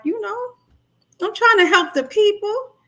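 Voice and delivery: high pleading quote voice; self quote. Retold or imitated. To her live viewers, quoting herself in a high earnest voice. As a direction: pitch way up, earnest, like pleading your case. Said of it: "So I'm like".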